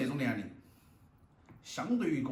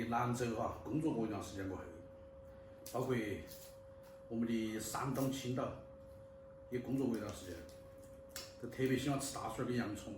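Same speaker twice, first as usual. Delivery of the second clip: talking with a mouthful; chewing while talking; 7 dB quieter than usual. Real, not acted. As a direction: chew between words. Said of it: "still eating while talking".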